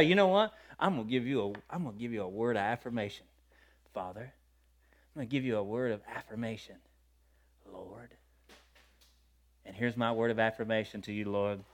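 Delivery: hushed, low address to God; intimate prayer. Acted. Believer giving the Lord a word of affirmation, intimate prayer. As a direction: drop right down, as if speaking privately to the Father.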